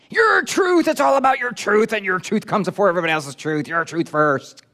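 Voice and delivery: high, shouted slogan easing off toward the end; fist-shaking protester. Acted. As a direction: shout it like an indignant crowd demanding its due. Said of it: "shaking their fist in the air".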